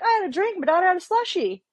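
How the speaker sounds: telling investigators about the late night, high and nonchalant; breezy retold answer. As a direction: brush it off like it's nothing. Retelling her plain explanation.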